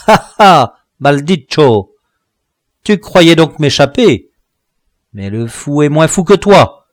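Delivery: loud, taunting villain dwarf; mocking dwarf, loud and triumphant; gloating dwarf. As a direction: crow over your trapped prey. Dwarf threatens the trapped hero.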